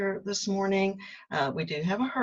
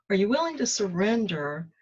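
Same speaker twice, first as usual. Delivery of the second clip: normal talking voice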